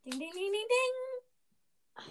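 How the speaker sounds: game show ding. Acted. Game show bell sound.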